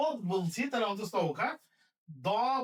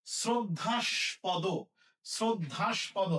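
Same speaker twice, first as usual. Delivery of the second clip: শ্রদ্ধাস্পদ said slowly and carefully; slow pronunciation; much slower than usual. Shown as a demonstration. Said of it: "তাহলে".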